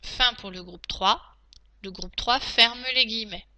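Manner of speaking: usual speaking voice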